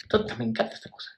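quick, breathy whispered aside to herself; soft delighted whisper. Real, not acted. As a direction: drop to a fast whisper, just for yourself.

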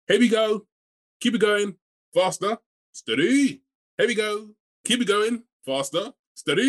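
high, chanted workout cues in a loop; fitness class instructor. Retold or imitated. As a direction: mimic an instructor cheering the class along. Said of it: "she says the same things".

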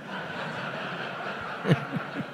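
silent wheezing laugh; real breathy laughter. Real, not acted. Laughing at own story.

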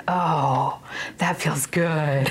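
slow, drawn-out contented sigh of words; mock-blissful, lingering on each word; savoring relief. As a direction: sink into it with exaggerated pleasure. Acting out relief at soft Bible.

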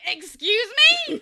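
loud, slow, offended; indignant mock. [name] reacting to Kenzie's jab, loud and slowly indignant. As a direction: act insulted, stretch it out.

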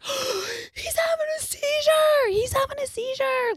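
high, drawn-out mock scream; panicked scream. Cat owner crying for help on the bus, mock panicked scream. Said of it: "screaming at the top".

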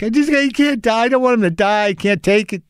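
high, desperate panic over a sick kitten; frantic, on the verge of tears; panicked pleading. Retold or imitated. Panicked owner begging the vet to save his kitten, high desperate pleading. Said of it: "I'm like".

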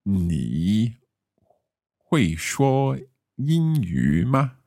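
slow, careful 你会说英语吗; careful learner's Mandarin. Shown as a demonstration. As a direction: ask Crystal slowly, syllable by syllable. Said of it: "can I ask you".

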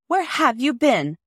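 calling out loudly from the wall; shouted. A narrator's reading. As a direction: shout it up from the top of the yard wall. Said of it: "shouted Peter".

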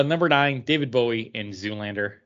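usual speaking voice

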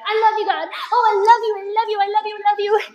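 high, loud, repeated gushing; frantic striving. Acted. Believer straining to prove love to God, frantic striving. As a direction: pour it out in over-eager mock devotion. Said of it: "And I'm striving".

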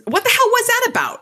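high, loud what the hell was that about; demanding. A narrator's reading. Harry rounds on the gunman, loud demand. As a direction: fire it off as a hot demand. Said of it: "I demanded".

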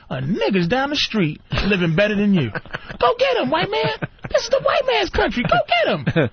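mock rich instigator pointing him at a target.

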